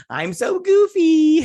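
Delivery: high, loud, slow goofy squeal with a crying edge; mock goofy self-pity, high and loud. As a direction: ham up a goofy wail. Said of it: "so she goes all goofy".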